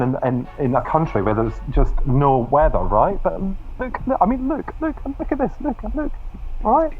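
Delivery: high, loud and excited, pointing at the rain; look, look, look, pitch rising each time. Real, not acted. Showing cohosts the Irish rain, excitedly loud and high.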